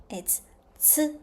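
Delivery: whispered hint. Shown as a demonstration. Teacher whispering the answer to the class. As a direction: breathe the answer softly.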